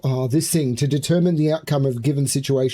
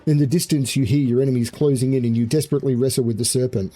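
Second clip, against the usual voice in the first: quicken the pace, rushing; fast urgent narration; faster than usual. Acted. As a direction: tell it in a hurry as enemies close.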